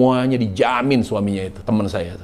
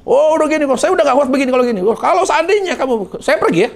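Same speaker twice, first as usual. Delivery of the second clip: high, fast mock threat to leave; husband's threat; 12 semitones higher, 4 dB louder, faster than usual. Husband threatening his wife that he is leaving. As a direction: rattle it off high like the fed-up husband. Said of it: "Terus suaminya ngancam nih".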